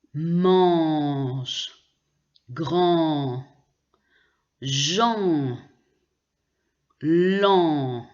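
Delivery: mange, grand, Jean, lent, each nasal vowel held long; nasal vowel examples. Shown as a demonstration. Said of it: "on ne prononce pas le N". (« mange, grand, Jean, lent » très allongés, le N muet)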